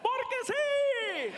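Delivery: high, slow drawn-out DJ voice; Mexican radio DJ. Retold or imitated. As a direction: stretch it like a hyped host. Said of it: "Why are you talking like that?".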